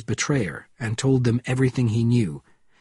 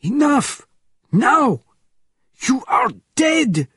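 high, loud furious shouting; screamed threat; 13 semitones higher, 5 dB louder, slower than usual. A narrator's reading. Frenchman to the nurse before he fires, screamed. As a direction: scream each word as a final sentence. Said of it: "screamed the man known as".

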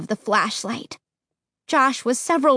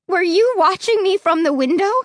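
crying out; 6 semitones higher than usual. Acted. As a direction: cry out in fear. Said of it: "I cried".

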